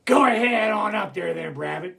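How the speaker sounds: growling, rasping wolf voice; wolves. Shown as a demonstration. Wolves sending Brer Rabbit up to bed, growling. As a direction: growl it out with claws up. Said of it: "Like this".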